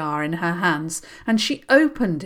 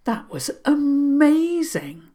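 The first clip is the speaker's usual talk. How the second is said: slightly stunned; 8 semitones higher, 4 dB louder, slower than usual. Acted. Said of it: "slightly stunned".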